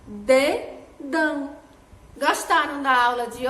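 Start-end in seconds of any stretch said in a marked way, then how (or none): 0.1-1.6 "Dedão" said very slowly, syllable by syllable; slow syllable demo. Shown as a demonstration. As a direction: stretch "Dedão" so kids hear each part. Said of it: "e finaliza com dão". ("Dedão" devagar, separando as sílabas)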